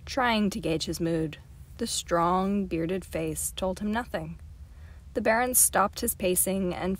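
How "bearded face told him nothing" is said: ordinary delivery, nothing marked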